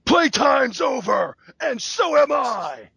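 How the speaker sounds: high, drawn-out mock holler; hammed-up, stretched-out battle cry; imitated wrestler yell. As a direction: yell it slowly like a goofy catchphrase. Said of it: "he yells".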